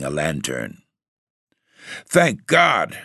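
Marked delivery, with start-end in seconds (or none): 2.0-3.0 relieved exclamation. Acted. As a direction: burst out in thankful relief. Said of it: "I exclaimed".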